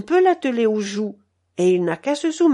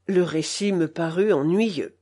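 ordinary delivery, nothing marked

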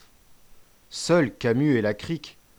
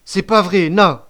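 put-on high, loud childish protest; stubborn denial; 8 semitones higher, 12 dB louder, slower than usual. A narrator's reading. Accused boy still denying it, high stubborn protest. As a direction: insist like a defiant child. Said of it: "continua-t-il à nier".

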